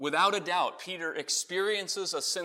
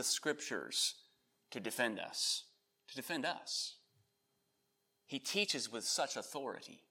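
lightly voiced, soft-spoken Peter; a hint of a hushed, reverent witness; imagined Peter testimony; 2 semitones lower, 5 dB quieter than usual. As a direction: recount it quietly, as an admiring eyewitness. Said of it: "Peter could have said something like that".

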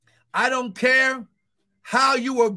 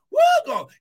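high, loud joyful whoop; ecstatic high shout; 6 dB louder, much faster than usual. Real, not acted. Pastor whooping at his congregation, ecstatic high shout. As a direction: let the glory burst out of you.

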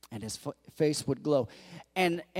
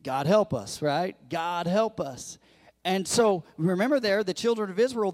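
normal talking voice

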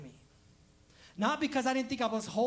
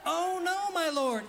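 high wailing start, falling away; dramatic Bible reader; 8 semitones higher, slower than usual. Retold or imitated. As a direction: lament it like a theatrical narrator. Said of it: "Just like that".

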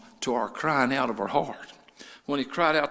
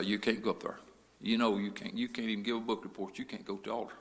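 lightly voiced devil, low and soft; devil's discouraging voice; 5 semitones lower, 6 dB quieter than usual. Devil talking a shy boy out of the altar, low and discouraging. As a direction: discourage them in a low, quiet undertone. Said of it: "the devil would say".

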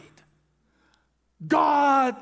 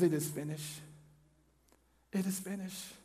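soft and lower, almost spent; faint last words; 7 semitones lower, 14 dB quieter, faster than usual. Acted. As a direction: let the last breath carry it. Dying Jesus on the cross.

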